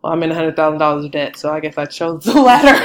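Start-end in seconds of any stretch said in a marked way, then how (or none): 1.4-2.9 breaking into laughter at the end; laughing admission. Real, not acted. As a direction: own up with a rueful laugh. Joking admission about own debt.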